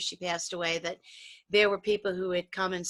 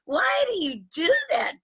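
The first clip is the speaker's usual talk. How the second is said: mock exasperated whine; 9 semitones higher, 4 dB louder than usual. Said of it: "it was like".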